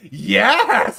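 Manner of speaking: imitating a loud, high "Yes!" in a European accent; vague European accent. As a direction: shout it like a mock European host. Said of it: "a vague European accent".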